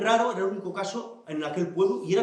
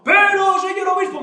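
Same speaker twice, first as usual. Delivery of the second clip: high, loud mock-tearful plea; nearly crying; 13 semitones higher, 8 dB louder than usual. Manolona begs the offended-at bishop, nearly crying. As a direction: wail it as a hammed-up protest. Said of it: "Manolona casi llorando".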